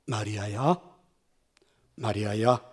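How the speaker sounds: a risen teacher softly calling a grieving woman by name; soft tender call. A narrator's reading. Jesus gently calls Mary, soft and tender. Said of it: "그분이 부드러운 소리로 이렇게 말씀하셨습니다".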